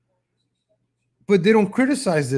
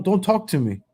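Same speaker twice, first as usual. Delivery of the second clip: usual speaking voice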